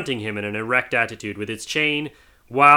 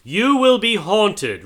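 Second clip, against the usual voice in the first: high, slow, louder doom; ominous ghost; 10 semitones higher, 5 dB louder, slower than usual. Acted. Marley's ghost foretelling hauntings, ominous. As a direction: intone it like a sentence.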